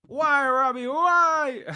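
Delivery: high, loud mock wail; hammed-up why, Robbie; mock anguish. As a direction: wail at your friend in fake despair. Mock lament at friend.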